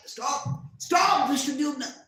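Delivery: high, loud shout building on the repeat; screamed plea. A narrator's reading. Warriors and Arjuna see him charging, screamed plea. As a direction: shout desperately to halt a charging man. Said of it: "Arjuna screamed at Drishtadyumna".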